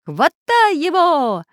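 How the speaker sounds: high, loud, drawn-out order; urgent shout. A narrator's reading. Artist and professor urge the spy, shouting. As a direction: yell it, stretching the words. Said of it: "одновременно закричали Карандаш и профессор".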